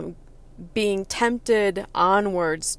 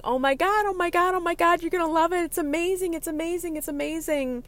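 high excited chant of oh my God; gushing friend; 9 semitones higher, faster than usual. Retold or imitated. Friend at the restaurant teasing the present, high and gushing. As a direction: hype it up, over and over.

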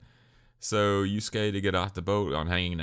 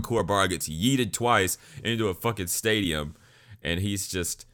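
usual speaking voice

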